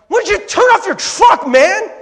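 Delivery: high-pitched full-volume shout; acted-out frustrated yell, very loud; yelling at the car. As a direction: holler it in exasperation. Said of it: "I'm yelling at the car".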